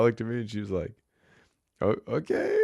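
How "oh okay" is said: surprised. Retold or imitated. Noelle's surprised reaction.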